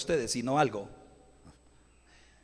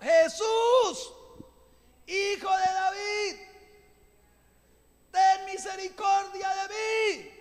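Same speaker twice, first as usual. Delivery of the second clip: much higher, slow desperate shout; Bartimaeus crying out; 12 semitones higher, much slower than usual. Acted. Blind Bartimaeus desperately shouting to Jesus for mercy. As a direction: cry out to Jesus from the roadside. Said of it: "tiene que gritar como Bartimeo".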